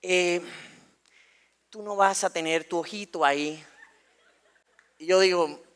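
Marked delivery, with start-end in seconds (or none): 0.0-3.6 slow, softened psychologist voice, lightly put on; careful school psychologist. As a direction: break it to a child haltingly. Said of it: "Y ella me dice". (psicóloga que habla despacio y con cuidado)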